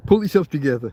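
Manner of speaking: normal talking voice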